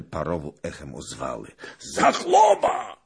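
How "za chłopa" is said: high, loud, stretched cry; echoing full-voiced exclamation; astonished shout. A narrator's reading. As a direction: cry out on recognizing someone. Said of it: "w twarz mu spojrzał i krzyknął".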